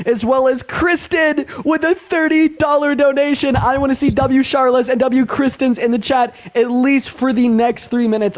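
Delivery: high-pitched donor shout-out; hype announcer. Acted. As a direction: celebrate donors to the whole crowd.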